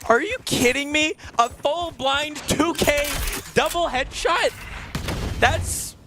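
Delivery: amazed shout. Real, not acted. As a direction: yell it in total disbelief. Caster amazed by double headshot.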